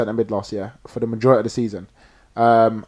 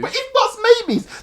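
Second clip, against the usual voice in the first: incredulous retort. Real, not acted. Throws rival's excuse back at him.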